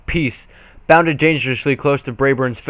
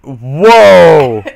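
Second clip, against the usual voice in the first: startled whoa; 10 dB louder than usual. Real, not acted. Reader reacts to the scene.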